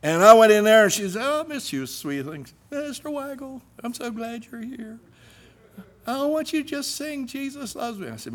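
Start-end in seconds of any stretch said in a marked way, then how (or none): 1.2-8.1 lightly voiced sweet teacher, higher and softer; a hint of a gushing teacher; music teacher voice. Teacher greeting new student, lightly voiced high and soft.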